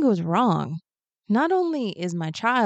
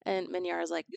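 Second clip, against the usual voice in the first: ordinary delivery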